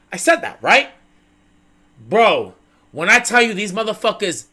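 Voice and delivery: normal talking voice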